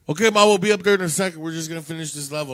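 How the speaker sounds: high, loud, hurried reply; calling back. Retold or imitated. Boy putting off his mom mid-game, calling back hurriedly. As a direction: shout back quickly to a parent upstairs.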